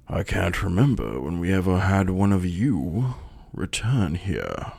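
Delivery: slow, stately elder with drawn words. Acted. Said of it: "He goes".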